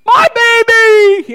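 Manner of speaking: very high, very loud drawn-out holler; frantic mama's cry. Acted. Mama calling her child across Walmart, acted frantic yell. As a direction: holler across the whole store, acting it up.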